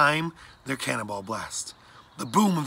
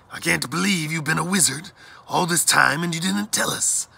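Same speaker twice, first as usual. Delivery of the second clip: put-on higher, animated boyish outburst of amazement; excited disbelief; 6 semitones higher, 4 dB louder than usual. A narrator's reading. Blackbird skipping beside his wizard friend, excited. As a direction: gush in lively disbelief.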